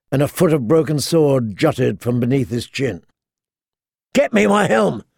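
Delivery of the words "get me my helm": rough growled order, pitched up. Acted. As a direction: growl it at her, demanding. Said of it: "Clegane growled at her".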